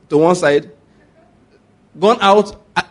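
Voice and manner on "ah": ordinary delivery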